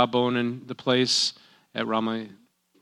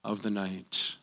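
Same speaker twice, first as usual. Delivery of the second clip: "of the night" repeated much softer and lower, almost to himself; quiet echo; 7 semitones lower, 13 dB quieter, slower than usual. Shown as a demonstration. Preacher echoing the name's meaning softly to himself, quiet. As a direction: let "of the night" fall away in a low murmur. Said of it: "Her name literally means".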